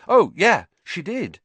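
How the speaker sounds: high, loud hasty agreement, dropping off; forced agreement. Acted. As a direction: back up a lie on cue. Friends back up the lie.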